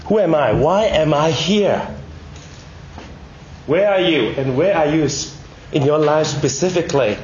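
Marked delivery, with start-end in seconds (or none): none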